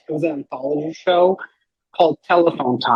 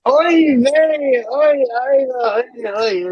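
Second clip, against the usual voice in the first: much higher, louder hammed-up oy vey; 10 semitones higher, 5 dB louder, much slower than usual. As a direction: wail oy vey like a fussing busybody. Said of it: "like a couple of busybodies".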